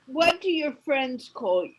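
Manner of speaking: ordinary talk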